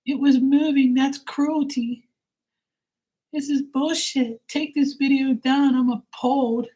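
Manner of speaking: very high squeaky mock outrage; shrill parody of an upset commenter. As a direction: falsetto caricature of a horrified viewer. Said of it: "they're like".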